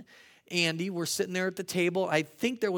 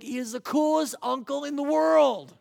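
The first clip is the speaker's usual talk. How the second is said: put-on louder, higher delighted kid voice; excited; 9 semitones higher, 6 dB louder than usual. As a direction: gush like a thrilled nine-year-old. Kid's delighted thought.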